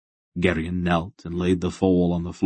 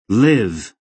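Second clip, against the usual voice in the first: commanding; 3 semitones higher, 7 dB louder than usual. A narrator's reading. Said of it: "he said".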